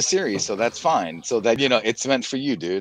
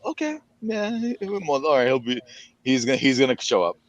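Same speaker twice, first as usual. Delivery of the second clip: lightly voiced own realization, pitched way up; imitating own thoughts; 4 semitones higher than usual. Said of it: "that's when I kinda figured".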